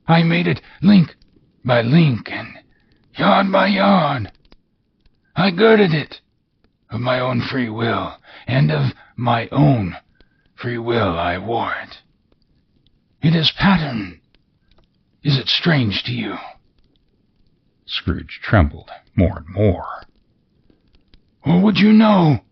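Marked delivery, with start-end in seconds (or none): 0.1-16.4 high, wailing otherworldly voice; mournful ghost. Acted. 21.4-22.4 high, loud wailing spectre; eerie ghost; ghost's voice. Acted.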